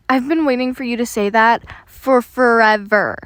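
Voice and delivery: loud, giddy relief; relieved, a bit giddy. Acted. Larry answering his friend's invitation, relieved and giddy. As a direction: let the relief burst out on forever. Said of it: "Larry sounded relieved and a bit giddy".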